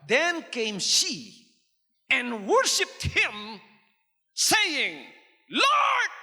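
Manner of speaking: very slow reading building to a high cry; dramatic scripture reading. Acted. Preacher acting out the woman's plea, slow then crying out. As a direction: draw the verse out, then cry Lord high.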